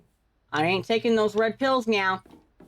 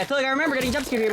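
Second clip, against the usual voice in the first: rattled off fast and a bit higher; rapid retold inner voice; quick quoted thought; 6 semitones higher, much faster than usual. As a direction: blurt the memory out in a rush. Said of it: "I was like".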